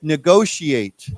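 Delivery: negotiate said slowly, syllable by syllable; slow model word. Shown as a demonstration. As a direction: spell out negotiate slowly for the class. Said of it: "sh sound".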